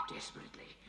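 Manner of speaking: whispered; spooky ghost tour hush. A narrator's reading. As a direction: breathe the word. Tense climax of the tale.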